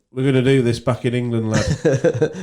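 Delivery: broad Yorkshire impression of a mate; Yorkshire accent. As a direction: quote him in a mock Yorkshire accent. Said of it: "doing that accent".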